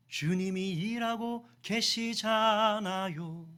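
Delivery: very slow, sustained "주님이 일하고 계시잖아요"; long drawn-out emphasis. Real, not acted. Preacher repeating the assurance to the congregation, long drawn-out delivery. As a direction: stretch the vowels all through "주님이 일하고 계시잖아요".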